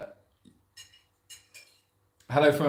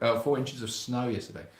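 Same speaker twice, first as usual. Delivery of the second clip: ordinary talk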